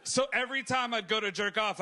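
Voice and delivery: usual speaking voice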